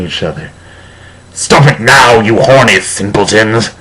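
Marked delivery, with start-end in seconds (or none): 1.3-3.7 higher, louder scolding shout; shouting professor. A narrator's reading. Professor McGoggle scolding the two students, hammed-up shout. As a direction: bark it at the misbehaving students. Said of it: "shouted Professor McGoggle".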